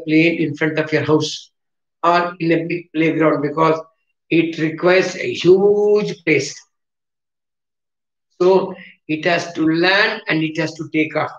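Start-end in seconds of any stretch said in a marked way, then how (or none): 5.3-6.2 HUGE drawn out long; emphatic stretch. Real, not acted. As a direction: stretch the vowel in "huge" to show size. Stressing how much space planes need.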